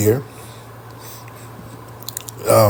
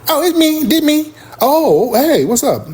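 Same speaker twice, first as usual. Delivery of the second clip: much higher, loose and casual; 13 semitones higher, slower than usual. Retold or imitated. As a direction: girlfriend dropping the work voice.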